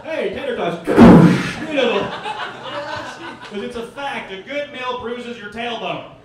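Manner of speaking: loud, high hammed-up commercial pitch; mock ad announcer. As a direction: sell tater tots like a TV ad.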